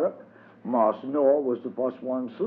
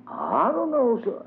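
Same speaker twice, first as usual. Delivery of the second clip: put-on higher childish voice, innocent; child's voice, much higher; little boy. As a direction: pipe up like a small boy dodging the question. Child answers the teacher.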